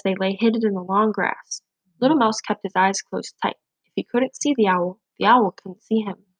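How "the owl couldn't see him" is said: ordinary delivery, nothing marked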